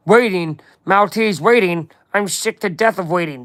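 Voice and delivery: slurred, indignant crime-boss voice; fed-up boss character voice; Kingpin. Acted. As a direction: put on an impatient mob boss with mushy S sounds. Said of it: "it's my Kingpin voice".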